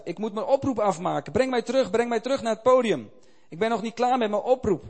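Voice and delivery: put-on the old warrior cries out, insistent; crying out. A narrator's reading. Old missionary begs the doctor to return him to the stage, loud and urgent. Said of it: "riep de oude strijder uit".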